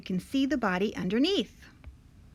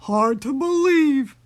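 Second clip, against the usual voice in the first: big, loud monster voice; Gruffalo voice; 3 semitones higher, 9 dB louder than usual. A narrator's reading. Gruffalo marvels at mouse's claim, loud monster voice. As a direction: boom it out as a storybook beast. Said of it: "said the Gruffalo".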